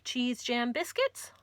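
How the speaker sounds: ordinary delivery, nothing marked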